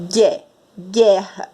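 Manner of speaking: "gué, guerra" slowly, hard g straight into eh; first syllable "gué" alone, then "guerra" without u; silent U. Shown as a demonstration. Teacher corrects back to the normal word, silent U. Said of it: "Não dá pra ouvir".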